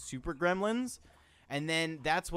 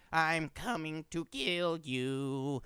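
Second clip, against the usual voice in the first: creepy Furby voice; 3 semitones higher, slower than usual. Retold or imitated. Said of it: "they'd be like".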